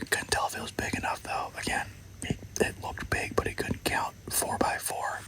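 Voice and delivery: whispering so the deer won't hear; hushed field whisper, unvoiced; hunting whisper. Real, not acted.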